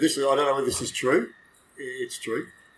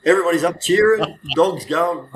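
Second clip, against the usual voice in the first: ordinary talk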